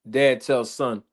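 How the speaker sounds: ordinary talk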